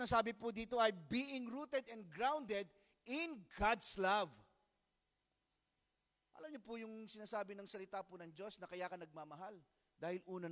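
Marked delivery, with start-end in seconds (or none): 0.9-4.3 slow scripture reading. Shown as a demonstration. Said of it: "Sa ibang translation".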